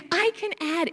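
high, bouncing joy; a kid repeating his triumph; excited child. Retold or imitated. Boy jumping up and down.

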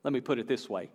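normal talking voice

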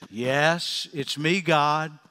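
low, slow, calm answer from above; voice of God. Retold or imitated. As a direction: speak low and unhurried as God. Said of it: "He says".

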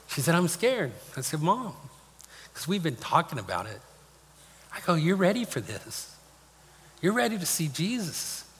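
emotional, pitch lifting on the quoted words; choked up. Real, not acted. Son retelling how he reassured his dying mother, choked up. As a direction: push on through tears, halting. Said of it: "I didn't think I'd get that emotional".

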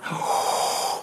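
long, airy gasp of wonder; excited gasp. Acted. Preacher showing a little child's excitement, gasping. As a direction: suck in breath like an amazed child.

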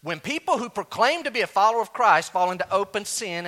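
preacher repeating the point; repeated for emphasis. Shown as a demonstration. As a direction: say it again so it lands. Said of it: "Can I say that again?".

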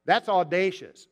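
ordinary talk, nothing marked

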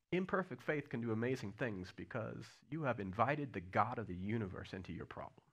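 ordinary delivery

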